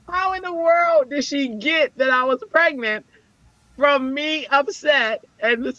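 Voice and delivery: high-pitched, incredulous wonder; amazed disbelief. Real, not acted. Marveling at counselor guessing pregnancy, high amazed disbelief. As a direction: exclaim it way up high, baffled.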